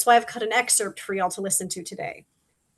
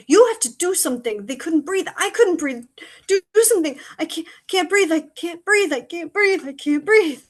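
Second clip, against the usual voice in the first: rising panic, louder and higher, breathless pleas; panicked gasping; 5 semitones higher, 5 dB louder, faster than usual. Acted. Crow begging Scarecrow as the air gives out, panicked and breathless. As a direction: gasp out each plea as the air runs out.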